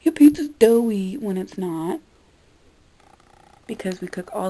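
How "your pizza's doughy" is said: loud mock Karen complaint; lightly hammed-up indignant diner; imitating a Karen. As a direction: complain loudly as a fussy customer. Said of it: "they become a Karen and go".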